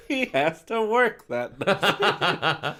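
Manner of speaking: slow through laughter; laughing. Real, not acted. Laughing at the plot.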